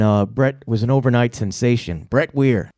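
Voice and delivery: normal talking voice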